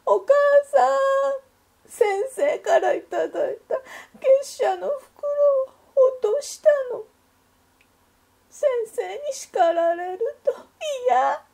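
high, loud, tearful little duck voice; crying child. A narrator's reading. Little duck crying to her mother about the lost fee envelope, high and tearful. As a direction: sob it out like a scared small child. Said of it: "アヒルさんは泣きながら学校から".

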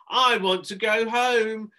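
high, loud, slow plea; a scared boy begging to leave; loud high panicked wail; panicked cry. A narrator's reading. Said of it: "Harrison cried".

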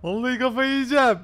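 high, loud frustrated shouting; angry outburst. Real, not acted. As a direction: shout it, building to a peak. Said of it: "Kızınca tatlışko oluyorsun".

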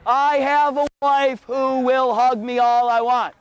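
usual speaking voice

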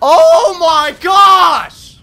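raging gamer shouting at the screen; real anger. Real, not acted. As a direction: let it burst out drawn long. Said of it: "I am pissed off right now".

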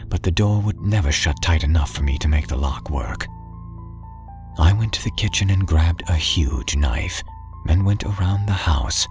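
ordinary delivery, nothing marked

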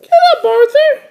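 very high, quick little-sister voice. Acted. Little sister ordering her brother up, high and quick. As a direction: call it out fast in a squeaky kid voice. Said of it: "D W laughed".

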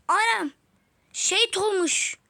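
high, loud, drawn-out shock; loud stunned exclamation; shocked outburst. Real, not acted. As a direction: cry out as the teammate falls. Teammate suddenly dies.

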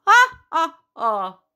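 high, loud "ah, ah" laugh; short theatrical witch laugh, falling away; witch's cackle. A narrator's reading. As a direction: cackle like a pleased storybook witch. Witch laughs after her proposal.

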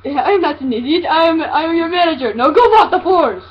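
normal talking voice

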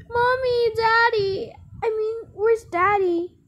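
very high, loud play-acted child; little child voice. Child asking Mommy where Daddy is, high child voice.

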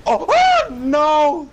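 high anguished no; raging gamer. Real, not acted. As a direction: wail as you lose. Dying in the game.

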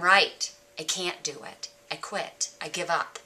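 low, soft, resigned murmur; very quiet, deflated surrender; defeated inner voice. Retold or imitated. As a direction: give up, voice sinking away. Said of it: "when I listen to the other voice".

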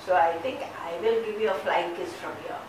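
ordinary talk

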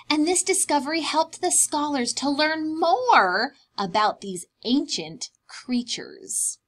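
plain reading voice

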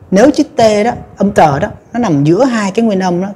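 usual speaking voice

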